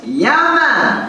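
high-pitched, swooping Jamaican accent. Shown as a demonstration. Comic showing how to answer every question, Jamaican accent. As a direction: answer with a hammed-up Jamaican lilt. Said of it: "in a Jamaican accent".

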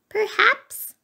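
very high little mouse voice; coy mouse. A narrator's reading. Mouse caught under the bed, coy high answer. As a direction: admit it coyly in a tiny squeak.

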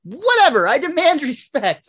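high, loud indignant yell; Milhouse. Acted. Milhouse standing up to Bart, indignant outburst. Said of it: "Use your indoor voice".

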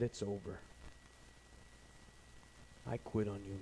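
low, soft resignation; hushed surrender, pitch dropped; defeated giving up. Acted. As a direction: give up quietly. Acted believer giving up.

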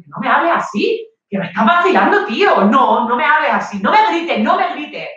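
angry protest. Acted. Girlfriend shouting back at Antonio, angry protest. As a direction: stand up to him, raising pitch.

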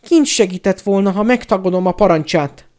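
high, loud plaintive whine; whining. A narrator's reading. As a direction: whine helplessly in self-defence. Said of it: "nyafogta Pettigrew".

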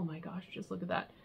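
hushed, breathy look at that; awed whisper. Real, not acted. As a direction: whisper it in admiration. Admiring sweater texture.

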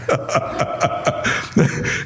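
quiet breathy chuckle; soft chuckle. Real, not acted. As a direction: chuckle under your breath. Amused by own remark.

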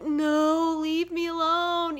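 slow, whiny inner plea to be left alone; mock-weary grumble in your head; inner groan. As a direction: drag it out like you're at your limit. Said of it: "inside, just like".

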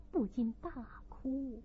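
plain reading voice

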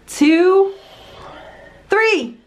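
ordinary talk

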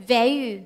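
"value" repeated as "vae-yu", L dropped; correct pronunciation. Shown as a demonstration. Teacher repeating for students, "value" correctly as "vae-yu". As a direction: model "value" with a "yu" ending. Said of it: "ตัวนี้ออกเสียงว่า".

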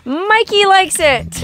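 high, slow, stretched-out delight; teasing singsong, pitched well up; playful catchphrase. Acted. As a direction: draw it out like a slogan. Playful catchphrase after the compliment.

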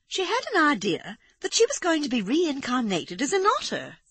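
high-voiced fluttery lady; a lady passing on odd gossip, pitched high; high, anxious young society woman; Amanda. A narrator's reading.

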